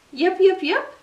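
high, loud yipping fox; fox yips. A narrator's reading. Little fox answering duck with high yips. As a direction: yip back brightly like a fox pup.

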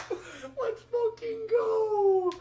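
long drawn-out victory scream; screaming celebration. Real, not acted. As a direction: scream it out in pure triumph. Celebrating the clutch.

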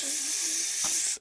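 long held "f-", soft hiss of air; isolated sound. Shown as a demonstration. Teacher sounding out fuel letter by letter, long soft consonant. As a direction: stretch out the "f-" softly.

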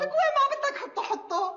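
high-pitched, rushed retort; high and fast, fed up; exasperated wife. Acted. Wife answers husband's fussing.

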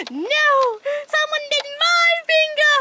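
gasp, then high shrill panic; panicked shriek. Acted. Bitten passenger panicking about the bug in the car, shrieking high. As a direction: shriek like something just bit you.

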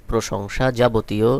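ordinary delivery

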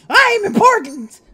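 high, loud puffed-up proclamation; pompous self-important. Pretend. Player hamming up his character's self-importance. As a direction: announce your own importance grandly.

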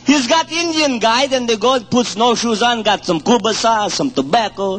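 put-on broken-English Ukrainian immigrant accent telling jokes; thick Ukrainian accent, dropped articles. Comedian telling hunting jokes to an audience in a Ukrainian accent.

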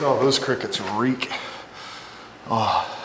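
ordinary talk, nothing marked

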